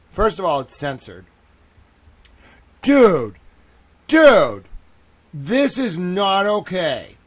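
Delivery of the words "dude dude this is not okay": loud, falling cries of dude; appalled, punched-out exclamations; real shock. Real, not acted. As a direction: protest in horror at what you see. Streamer shocked by horrifying footage.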